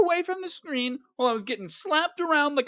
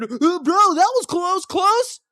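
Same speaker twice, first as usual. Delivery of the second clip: higher, louder relieved bro talk; imitating a close call thrill; hammed-up relief; mock relieved gamer; 6 semitones higher, 6 dB louder, slower than usual. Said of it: "he said".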